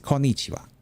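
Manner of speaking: Konnichiwa said quickly, Japanese-style; good pronunciation. Retold or imitated. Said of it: "he pronounces it pretty well".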